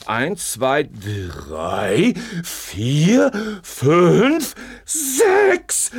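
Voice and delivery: slow, building count; rising excitement. Acted. Compares numbers, rising excitement.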